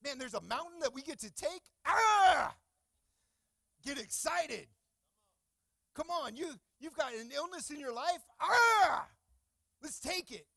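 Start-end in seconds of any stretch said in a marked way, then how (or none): 1.9-2.6 battle cry shout. Acted. Charging the mountain. 8.4-9.1 battle cry shout. Acted. Taking on illness.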